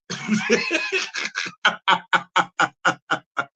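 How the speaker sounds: long laugh, high start into staccato chuckles; real drawn-out laugh. Real, not acted. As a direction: burst out high and keep chuckling. Laughing at own culture shock.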